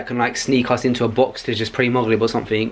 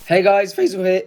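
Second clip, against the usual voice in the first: voice breaking; 5 semitones higher than usual. Real, not acted. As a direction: voice cracking on the greeting. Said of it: "I got a bit of a voice break".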